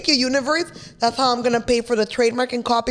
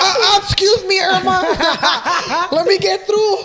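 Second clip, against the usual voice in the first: higher and louder through laughter; laughing; 8 semitones higher, 6 dB louder than usual. Real, not acted. Pushing past friend in a crowd, laughing loudly. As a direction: laugh while squeezing past.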